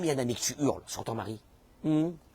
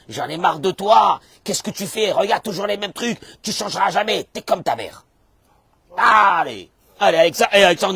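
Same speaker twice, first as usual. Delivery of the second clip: angry berating; 5 semitones higher, 8 dB louder than usual. Acted. Acted spouse berating partner.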